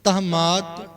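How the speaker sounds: ਤਹਮਾਤ said as one joined word, no full vowel on ਨ; correct pronunciation. Shown as a demonstration. Teacher showing how ਤਹਮਾਤ is pronounced. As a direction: say ਤਹਮਾਤ with the short clipped ਨਾ merged in. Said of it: "ਇਹਦਾ ਉਚਾਰਨ ਹੋਏਗਾ ਨਾ".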